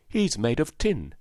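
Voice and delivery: put-on high-pitched little-girl explanation; Dorothy's voice. A narrator's reading. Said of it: "said Dorothy".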